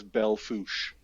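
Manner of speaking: Fouche as one syllable, "foosh"; local pronunciation. Shown as a demonstration. Naming a worse example, Belle Fouche said as "bell foosh". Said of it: "No, it's, that's pronounced".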